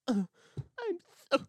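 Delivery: high-pitched mock sobbing with a sniffle; fake cry. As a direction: put on a whiny, exaggerated cry. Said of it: "that fake cry".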